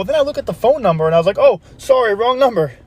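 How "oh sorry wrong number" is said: higher-pitched, sudden "oh, sorry"; sheepish apology. Acted. Caller who dialled the wrong house, suddenly apologetic. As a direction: apologise on realising the mistake, pitched up. Caller realises his mistake.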